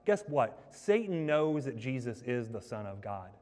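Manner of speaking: ordinary delivery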